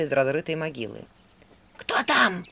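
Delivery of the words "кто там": put-on hoarse, old crone's voice; hoarse old woman. A narrator's reading. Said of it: "сиплый старушечий голос".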